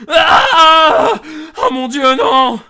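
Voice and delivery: high, very loud scream of horror; shrieking horror; horrified scream. Acted. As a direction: scream at what the torch shows. Sees the bodies by torchlight.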